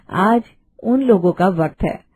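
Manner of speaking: usual speaking voice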